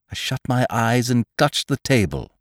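usual speaking voice

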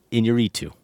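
"Iñárritu" as een-yuh-REE-too; Lubezki's pronunciation. Retold or imitated. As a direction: stress REE in "Iñárritu", not the A. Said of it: "where he thanked Iñárritu".

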